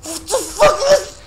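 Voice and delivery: very high, loud cry of disbelief; real startled screech; outraged shriek. Real, not acted. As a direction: shriek it at the game. Yelling at the game.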